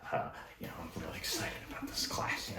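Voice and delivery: excited whisper. Real, not acted. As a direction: whisper it like a secret. Confiding aside after saying wired.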